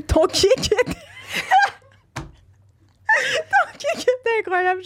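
high, broken words squeezed through laughter; crying with laughter. Real, not acted. Reading own childhood fanfiction aloud, crying with laughter. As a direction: choke on the quote, helpless with giggles. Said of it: "je pleurais".

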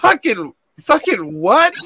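high, loud, incredulous; shocked outburst. Real, not acted. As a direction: blurt it out in disbelief.

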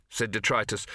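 usual speaking voice

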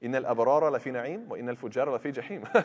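fast, chanted Quranic recitation. Acted. Teacher reciting the verse to students, chanted and fast. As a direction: recite the verse in full tajweed flow.

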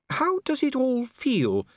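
high, slow and careful lady; a lady asking the troll cautiously; cautious Lady Sybil. A narrator's reading. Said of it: "playing for safety".

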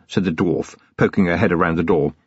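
ordinary talk

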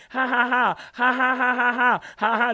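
exaggerated taunting ha ha ha; a hammed-up jeering laugh; mocking fake laugh. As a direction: laugh at him in mockery.

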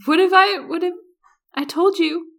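very high, loud jokey pitch; mock proposal. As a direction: tease her with a fake proposal.

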